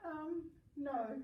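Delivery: ordinary talk, nothing marked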